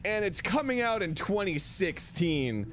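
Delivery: high, hammed-up reviewer declaiming; angrily spouting facts. Mock movie reviewer giving the release date, hammed-up anger. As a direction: announce a bare fact with mock outrage. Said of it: "angrily spouting facts".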